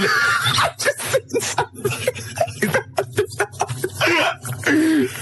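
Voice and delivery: real crying laughter; wheezing, breathless laugh; helpless high laugh. Real, not acted. Reacts to picture explanation.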